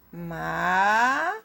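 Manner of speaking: MA held long, rising at the end; isolated syllable. Shown as a demonstration. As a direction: stretch MA out alone for the pupils. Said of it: "Primeiro você separa a sílaba". (MA isolado, sílaba bem esticada)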